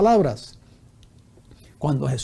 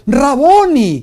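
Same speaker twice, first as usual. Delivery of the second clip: «Raboni» cried out high and loud; joyful cry; 9 semitones higher, 10 dB louder, much slower than usual. Acted. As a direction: burst out with «Raboni» in recognition. Mary recognizes Jesus, calls out. («Raboni» gritado, alto y agudo)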